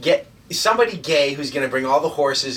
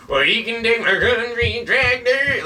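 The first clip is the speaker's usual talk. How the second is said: higher, slower mocking country drawl; mock country guy; 9 semitones higher, 4 dB louder, slower than usual. Mocking fake country folk to his co-host, higher slow drawl. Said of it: "and then they're fucking".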